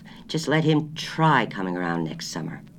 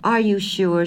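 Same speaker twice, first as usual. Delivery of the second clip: higher and louder, a little raised; 8 semitones higher, 5 dB louder, slower than usual. A narrator's reading. Wife repeating her order louder to grocer. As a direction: speak up so he can hear. Same question asked again.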